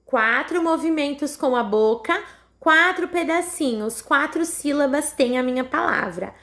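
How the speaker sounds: usual speaking voice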